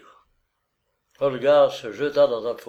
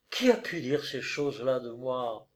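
plain reading voice

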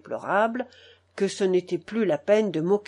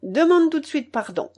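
raised, commanding voice; hard command, pitched up; harsh, authoritative order; 6 semitones higher, 4 dB louder than usual. A narrator's reading. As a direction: order it like a stern grandmother. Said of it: "durement et d'un ton d'autorité".

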